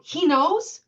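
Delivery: shocked. Retold or imitated. Shocked question in retold talk.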